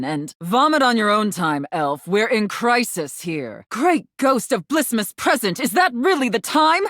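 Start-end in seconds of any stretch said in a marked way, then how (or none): 3.7-6.8 startled. Acted. Santa notices the late hour.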